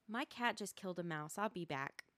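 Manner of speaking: ordinary talk, nothing marked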